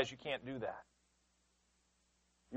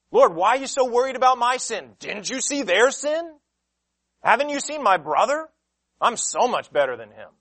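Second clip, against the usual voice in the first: higher, louder pleading self-defence; whining excuse to God; 5 semitones higher, 6 dB louder than usual. Pretend. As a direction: plead your case like a sulky child. Voicing a self-justifying sinner.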